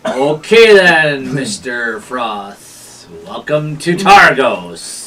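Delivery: drawn-out, slow official's welcome; town gatekeeper. Acted. Gatekeeper greets a new arrival, slow and drawn-out. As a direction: greet them slowly, stretching the words. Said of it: "and he's like".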